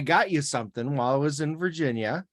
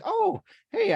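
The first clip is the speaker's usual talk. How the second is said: sudden idea. Retold or imitated. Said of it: "I was like".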